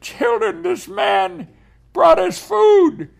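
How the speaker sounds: put-on high, loud, slowed call to the children; mother in tears telling her children food has come, high and loud. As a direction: cry it out to the kids, overjoyed.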